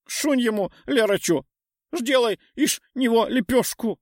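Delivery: high lisping snarl through fangs; lisping creature voice. A narrator's reading. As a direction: egg the troll on, toothy lisp. Morph eggs troll on.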